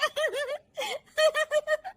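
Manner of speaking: high-pitched cackling giggle; high laughing fit. Acted. Daughter-in-law laughing at the TV, high giggles. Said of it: "बहू के हंसने की आवाज".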